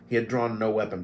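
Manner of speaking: usual speaking voice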